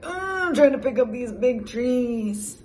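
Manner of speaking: a hint of a straining little toad; mild strained toad voice; lightly voiced toad. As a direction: grunt it like lifting heavy trees. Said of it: "Toad's like".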